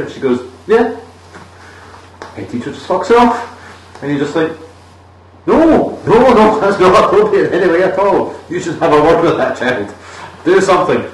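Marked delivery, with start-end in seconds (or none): 0.7-1.0 chirpy yeah. Retold or imitated. Teacher's chirpy reply quoted. 5.5-11.1 high, louder outraged no no no; exasperated protest. Retold or imitated. Viewer's outraged inner protest.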